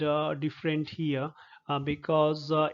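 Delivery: ordinary delivery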